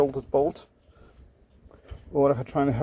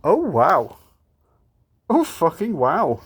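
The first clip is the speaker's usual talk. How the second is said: loud, stunned oh wow; can't believe your luck; amazed; 4 semitones higher, 5 dB louder, much slower than usual. Real, not acted. Amazed at unlikely find.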